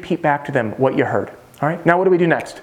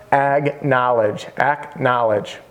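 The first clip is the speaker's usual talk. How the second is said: slow, drawn-out key word; slow, emphatic term; 3 semitones lower, much slower than usual. Shown as a demonstration. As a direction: stretch each syllable for the class. Spells out formula step slowly for class.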